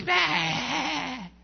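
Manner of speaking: a hint of a demon-possessed hiss, high and sudden; possessed girl. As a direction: lightly voice the caged girl's snarl. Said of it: "como uno se imagina cuando alguien está endemoniado".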